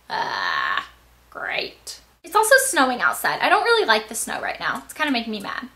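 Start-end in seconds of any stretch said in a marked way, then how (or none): 0.1-2.0 very low croak into a whisper; voice cracking. Real, not acted. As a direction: let the voice give out. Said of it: "I lost my voice".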